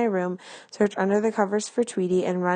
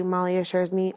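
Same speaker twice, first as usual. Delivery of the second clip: usual speaking voice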